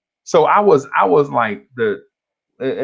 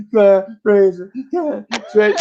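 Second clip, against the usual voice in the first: put-on little kid crying; 6 semitones higher, 4 dB louder than usual. Crying kid walking down the school hallway, imitated sobbing. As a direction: blubber the name in hitching pieces like a small child. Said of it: "you know how little kids be crying".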